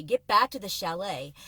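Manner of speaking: ordinary delivery, nothing marked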